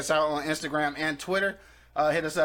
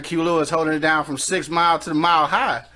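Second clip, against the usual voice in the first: ordinary talk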